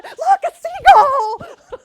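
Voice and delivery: high, loud, slow delighted cry; excited squeal. Retold or imitated. Delighted passenger squealing at a seagull on the ferry. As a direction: point and squeal with childlike glee.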